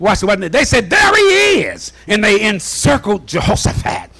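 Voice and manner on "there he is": high, drawn-out spotting cry; calling out. Acted. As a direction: call out slowly on a high pitch. Enemy spotting Jehoshaphat.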